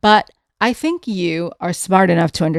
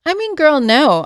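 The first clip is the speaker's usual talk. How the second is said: much higher, louder sassy dismissal; 10 semitones higher, 6 dB louder than usual. Real, not acted. Answering the influencer's trad wife denial, incredulous. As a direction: shut the claim down with disbelief.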